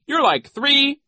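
very high, loud, cut off unfinished; mocking Nero. A narrator's reading. Nero begins another comparison, high mocking voice. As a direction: start a high jeer and stop short.

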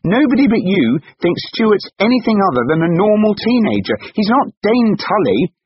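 high, loud defence; impassioned, full-voiced protest; angry shout. A narrator's reading. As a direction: shout down the accusation. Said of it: "shouted Tessa".

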